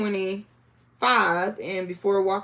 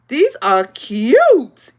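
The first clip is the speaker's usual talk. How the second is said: excited; 2 semitones higher, 9 dB louder, much slower than usual. Real, not acted. Admiring quilt blocks.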